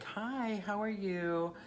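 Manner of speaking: high, bright-faked sing-song greeting; overly sweet high hello; fake cheerful hi. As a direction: put on a chirpy front for the ex.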